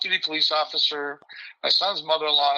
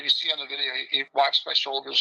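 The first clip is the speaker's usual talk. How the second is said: ordinary talk, nothing marked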